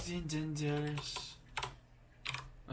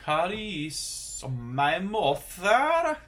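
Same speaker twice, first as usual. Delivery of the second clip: English said slowly with a mock Norwegian lilt, higher and louder; Norwegian accent; 6 semitones higher, 7 dB louder, much slower than usual. Learner slips into a Norwegian accent on English words. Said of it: "saying the English with the Norwegian accent".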